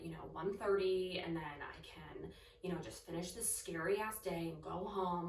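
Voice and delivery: soft, breathy begging to herself; whispered pleading. Retold or imitated. Frightened girl silently pleading to be left alone, whispered. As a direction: hush it like nobody may hear. Praying while watching the clock.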